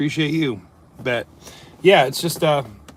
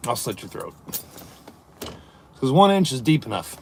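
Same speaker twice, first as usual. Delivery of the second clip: normal talking voice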